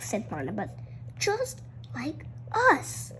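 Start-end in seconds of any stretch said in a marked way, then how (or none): 1.2-3.1 slow, soft, near tears; sad realization. Acted. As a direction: let it break as you admit it. Sad failed project realization.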